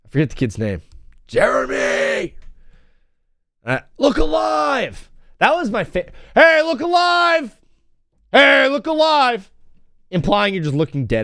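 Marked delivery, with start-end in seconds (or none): 1.3-2.3 high, drawn-out mock yell; slow, strained imitation holler; imitated screaming dad. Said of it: "my dad screaming from the dugout". 4.0-5.1 high, stretched mock yell; slow, high imitation shout; imitated screaming dad. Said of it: "my dad screaming from the dugout". 6.3-9.5 loud, high, drawn-out yell; slow, stretched mock dugout holler; imitated screaming dad. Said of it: "my dad screaming from the dugout".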